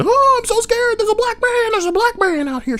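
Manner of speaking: high, breathy fake fright; mock scared Karen. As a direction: ham up a helpless damsel calling for help. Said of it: "putting on a show, acting like she's super scared".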